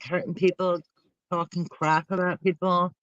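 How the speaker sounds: normal talking voice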